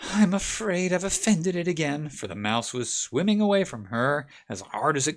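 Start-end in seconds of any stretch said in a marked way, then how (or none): 0.0-2.1 sorrowful. Acted. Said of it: "sorrowful tone".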